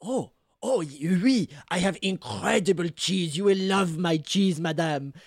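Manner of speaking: excited Frenchman talking up his wares. Acted. Cheese seller delighted by an order, excited French accent. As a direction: gush proudly in a French accent. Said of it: "Jean-Pierre is very excited about this".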